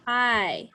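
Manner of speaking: I sung out clearly; modelled long I. Shown as a demonstration. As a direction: model the I for the kids. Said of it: "Do you hear the long I".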